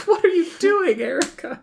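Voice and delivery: very high teasing disbelief; mock cringe. Host reacting to the cheesy French joke, very high mock cringe. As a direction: tease her like a cringing friend.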